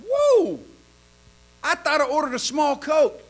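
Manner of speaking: high startled whoa at the size; a man amazed at his huge drink; surprised customer. Retold or imitated. Man to waitress, surprised high whoa.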